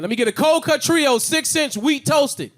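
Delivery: high, loud, very slow order; yelled sandwich order. Shown as a demonstration. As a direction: shout it through the glass. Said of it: "I gotta yell my order".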